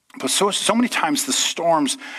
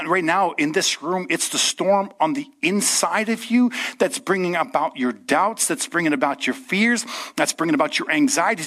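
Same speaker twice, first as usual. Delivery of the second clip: usual speaking voice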